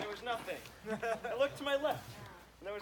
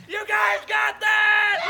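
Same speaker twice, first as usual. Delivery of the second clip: drawn-out camp counselor yell; loud shout; 8 semitones higher, 11 dB louder, much slower than usual. Shown as a demonstration. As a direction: slowly bellow it to the crowd. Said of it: "I couldn't hear that".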